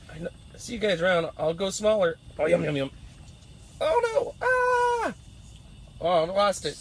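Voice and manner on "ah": sudden high cry; real dismayed cry; frustrated high shout. Real, not acted. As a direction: yell as the fish gets away. Loses the fish.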